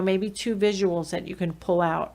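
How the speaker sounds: ordinary delivery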